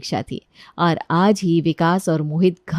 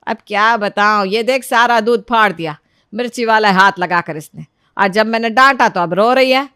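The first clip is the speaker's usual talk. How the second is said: louder, scolding complaint; exasperated mother-in-law, complaining; 5 dB louder than usual. Acted. Mother-in-law tells sons about the spoiled milk, loud exasperation. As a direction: grumble as a put-upon mother-in-law.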